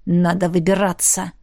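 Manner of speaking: low, brief grumble; grumbling. A narrator's reading. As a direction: grumble it under your breath. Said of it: "буркнул Джаф". (буркнуть низким голосом)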